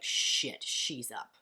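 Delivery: a devil grumbling that she woke up. Retold or imitated. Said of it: "I want the devil to be like".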